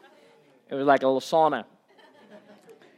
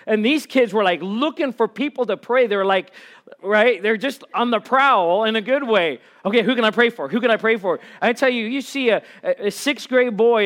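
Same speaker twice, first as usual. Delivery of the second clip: loud, high, driving sermon voice; fiery preaching; 6 semitones higher, 9 dB louder than usual. Acted. Camp pastor preaching to the church, loud and fired up. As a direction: build the crowd up to the rafters.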